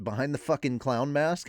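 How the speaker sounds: ordinary talk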